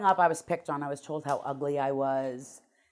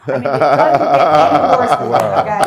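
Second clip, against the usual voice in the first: laughing loudly while telling it; 17 dB louder than usual. Real, not acted. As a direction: chuckle through the irony. Laughing at the omens.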